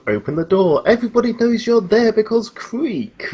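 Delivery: high, loud mocking quote; hammed-up retelling of the noisy entry; mocking retold voice. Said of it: "I don't want another repeat of".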